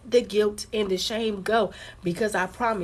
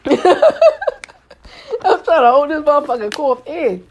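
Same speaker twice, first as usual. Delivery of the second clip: laughing, voice strained from holding back a cough; laughing through a held cough; 6 semitones higher, 12 dB louder than usual. Real, not acted. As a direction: laugh while choking down a cough. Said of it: "I'm trying to hold the cough in".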